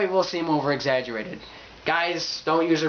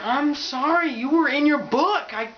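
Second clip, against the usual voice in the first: high-pitched protesting excuse; mock defensive apology; 9 semitones higher than usual. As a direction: plead innocence in a higher voice. Acting apology to startled reader.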